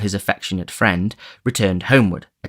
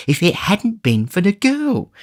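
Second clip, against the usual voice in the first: put-on much higher, pleading old man; 8 semitones higher, 4 dB louder than usual. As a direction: soothe him meekly.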